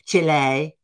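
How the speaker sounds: CHILE as a loud "CHEE-lay" in a mock Spanish accent. Host mocking news readers, overdone Spanish accent. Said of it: "all of a sudden it has to be".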